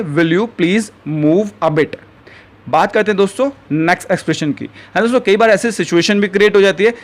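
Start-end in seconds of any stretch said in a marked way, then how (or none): 0.0-2.0 very slow, each word spaced out for learners; slow teaching model. Shown as a demonstration. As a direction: say it slowly and clearly like a teacher modeling a phrase. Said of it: "तो English में आप क्या बोलेंगे".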